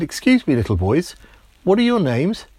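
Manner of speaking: put-on a child asking nicely; polite little boy. Timmy politely asks the boys.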